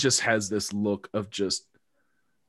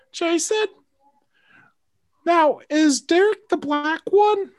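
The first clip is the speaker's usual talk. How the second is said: much higher, slow put-on mother; mock mom voice; 6 dB louder than usual. Mom asking about Derek, high slow mock mother. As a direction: innocent fussy mother in falsetto. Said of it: "my mom's like".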